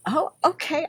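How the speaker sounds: flustered oh okay. Retold or imitated. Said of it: "I was like".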